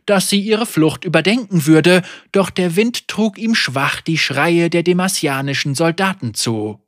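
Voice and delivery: ordinary delivery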